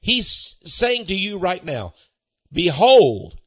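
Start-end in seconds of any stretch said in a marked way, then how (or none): 2.5-3.4 loud behold. Acted. Preacher to congregation, a loud calling Behold. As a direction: call it out loud. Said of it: "listen up".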